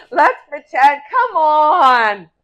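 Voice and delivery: loud, high nagging; pushy insisting. Acted. Wife insists the next day.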